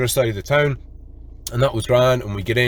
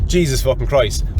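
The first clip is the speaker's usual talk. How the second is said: ordinary talk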